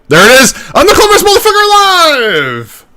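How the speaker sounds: very high, loud triumphant crow; triumphant gloat. Retold or imitated. Host mocking his own smug moment, shrieking in triumph. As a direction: shriek it like a proud genius. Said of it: "I'm like".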